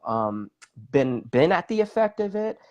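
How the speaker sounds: normal talking voice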